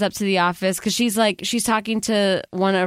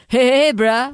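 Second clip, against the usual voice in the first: laid-back bro; 4 semitones higher, 4 dB louder than usual. Acted. Air traffic controller with feet up greeting, slacker bro. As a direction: hail a buddy like a slacker controller.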